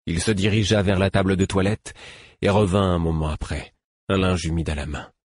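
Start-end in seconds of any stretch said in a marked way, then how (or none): none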